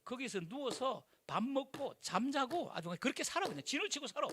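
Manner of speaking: ordinary talk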